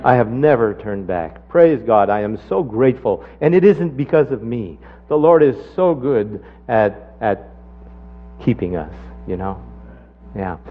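ordinary talk, nothing marked

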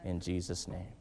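low, soft prayer voice; closing prayer. Real, not acted. Pastor closing the service in quiet prayer. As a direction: pray quietly over them.

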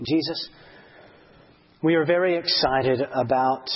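calm, earnest closing prayer. Acted.

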